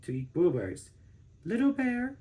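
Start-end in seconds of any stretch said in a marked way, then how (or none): 1.4-2.2 higher, soft calling of her cub; mother bear calling her cub; mother bear's voice. A narrator's reading. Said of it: "she said".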